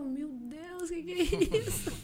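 high-pitched, amused disbelief; mock astonishment. Reacting to a child wanting a photo, mock astonishment. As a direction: exclaim high, as if overwhelmed.

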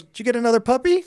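a hint of a bewildered mother; mock mom voice. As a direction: toss it off quick like a puzzled mom. Mom's surprised reply retold.

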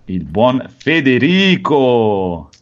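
very slow, stretched name; drawn-out introduction. Real, not acted. (presentazione strascicata)

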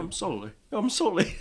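Mark Wahlberg. Retold or imitated. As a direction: imitate a touch of Southern twang, amused. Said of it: "a touch of a Southern twang".

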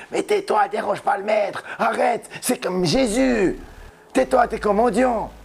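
crowd scolding. Acted. Crowd hushes the blind beggar, scolding. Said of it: "lui faisaient des reproches".

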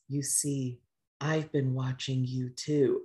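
quietly teasing confession; sultry hush. Retold or imitated. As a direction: lean in close and purr it softly. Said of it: "she goes".

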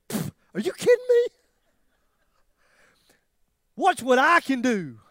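a mock-boastful God brushing it off; God scoffing. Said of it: "he'd go".

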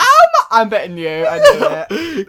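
high laughing words. Real, not acted. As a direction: squeal it through laughter. Laughing at phone screen time.

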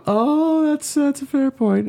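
very high mock mother conceding; terrible mom voice. Son voicing his mother conceding his point, high falsetto. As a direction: squeak it out like a mom giving in. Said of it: "this terrible voice".

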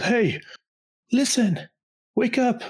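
high, chirpy little fairy. Retold or imitated. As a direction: squeak it up like a tiny fairy. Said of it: "there is this fairy who said".

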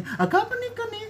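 very high-pitched needling question; squeaky-high mocking prying; mocking intonation. Shown as a demonstration. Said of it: "itu dari intonasinya udah".

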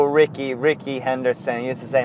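normal talking voice

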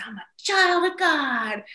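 put-on high, drawn-out excited kid's cry; excited little son. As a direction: proclaim it like a thrilled small boy. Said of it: "he gets so excited".

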